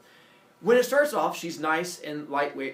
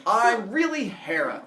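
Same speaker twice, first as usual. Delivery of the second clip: slow, high and louder, a goddess unmasking; grand goddess reveal; 9 semitones higher, 12 dB louder, slower than usual. Acted. Hera unveiling herself to Jason, slow grand reveal. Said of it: "She's like".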